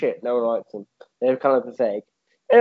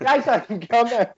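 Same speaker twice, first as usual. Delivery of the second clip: mock calling; 7 semitones higher, slower than usual. Heavy Rain Jason calling.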